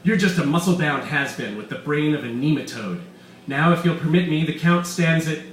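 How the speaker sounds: loud, gloating mad scientist voice; villain Weiss. Acted. Doctor Weiss gloating to his captives, loud and smug. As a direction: boast like a scheming villain.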